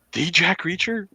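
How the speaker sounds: high, awed question with "The" said as "thee"; starstruck. Retold or imitated. Guy on the phone reacts to the famous caller, starstruck. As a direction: imitate a fan who can't believe who called. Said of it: "acts all starstruck".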